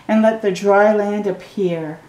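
usual speaking voice

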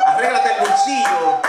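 high, fussing wife telling him to fix his pocket; fussy wife. Retold or imitated.

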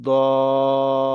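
দল with its vowel held very long before the l; four-alif stretch. Shown as a demonstration. Teacher showing the thick madd sign, দল greatly prolonged. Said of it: "চার আলিফ টেনে পড়বো".

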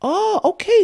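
high, sudden oh of surprise; real surprise. Real, not acted. Host realizing the sister isn't a revert, surprised. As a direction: jump up in pitch, caught off guard. Learns she is Pakistani.

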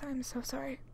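whispered apology. Real, not acted. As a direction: breathe it out. Hiccup apology, unvoiced.

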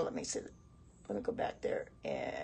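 "Let me go back there and" whispered under her breath; whispered aside. Real, not acted. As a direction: whisper it to yourself while scrolling. Muttering to herself mid-stream.

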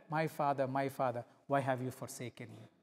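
low, soft, broken lament; whisper-like grief from the cross; the dying son pleading softly with his Father; quiet anguish. Acted.

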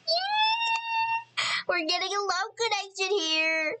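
very high squeal into high excited talk. Real, not acted. Player thrilled at a love connection between Sims, excited squeal. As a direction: celebrate with a drawn-out high squeal.

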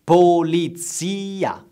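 Polizia drawn out, po-li-zi-a; slow teaching word; slow model word. Shown as a demonstration. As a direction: stretch Polizia for learners. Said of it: "Please repeat after me".

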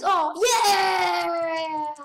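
loud happy shout; excited cheer. Real, not acted. Player cheering as his map gets voted, excited shout. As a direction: cheer as the vote comes through.

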